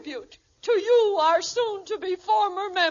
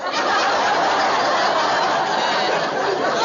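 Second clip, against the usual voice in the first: loud, breathy cackle; loud laugh; 6 dB louder than usual. Acted. Friend cackling after the punchline.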